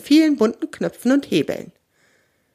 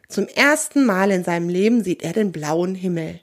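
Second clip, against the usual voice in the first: usual speaking voice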